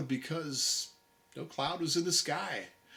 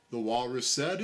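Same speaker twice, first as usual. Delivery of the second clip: ordinary delivery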